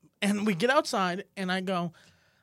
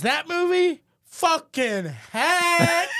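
drawn-out, higher, louder sneer; mock dismissive scoff; 7 semitones higher, 5 dB louder, much slower than usual. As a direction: imitate a snob trashing the film. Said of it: "Very matter of fact".

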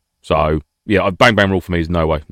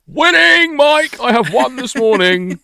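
much higher, loud victory cry; triumphant; 3 dB louder, much slower than usual. Pretend. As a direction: crow about winning, slowing at the end. Triumphant bathroom victory announcement.

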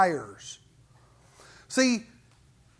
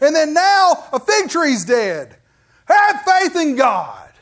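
fed up with Peter, hammering each point; exasperated emphatic Jesus; 3 semitones higher, 7 dB louder than usual. Retold or imitated. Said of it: "I believe it's emphatic".

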